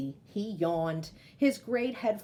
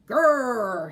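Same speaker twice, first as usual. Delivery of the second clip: loud, falling bear growl; sleepy bear growling as he heads off into the night; 8 dB louder than usual. Acted. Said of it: "He growled as he ambled".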